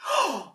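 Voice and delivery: high startled gasp; sharp gasp of surprise. Acted. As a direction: gasp as the man appears. Surprise at the big man.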